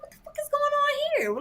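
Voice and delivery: a very high squeal of disbelief; shocked outburst. Retold or imitated. Seeing coconut milk in her curry, very high shocked squeal. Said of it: "I'm like".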